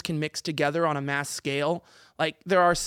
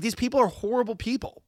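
ordinary talk

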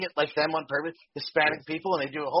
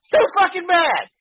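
ranting; 11 semitones higher, 4 dB louder than usual. Real, not acted. Ranting about intolerance.

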